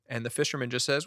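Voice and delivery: ordinary talk, nothing marked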